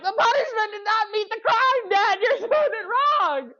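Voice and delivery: high, loud protest through laughter; mock outrage at the unfair sentence, laughing. Son protesting to his dad about the punishment, laughing outrage.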